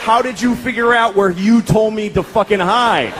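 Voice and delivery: mock-impressed, dripping sarcasm; sarcastic wow. Father mock-praising his daughter's finding skills. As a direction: pretend to be amazed, sarcastically. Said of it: "And I'm like".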